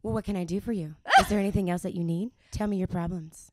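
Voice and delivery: loud, playful hotline come-on; pretend sex-line operator. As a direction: play a phone-line operator, hammed up. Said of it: "I'm gonna be a sex operator".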